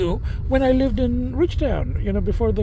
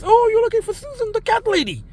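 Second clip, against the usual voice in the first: imitating the lady; 11 semitones higher than usual. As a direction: play the cheery neighbour lady, pitched way up. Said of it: "and she goes".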